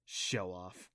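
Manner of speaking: low, soft mutter. A narrator's reading. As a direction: mutter it under your breath. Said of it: "Adam muttered".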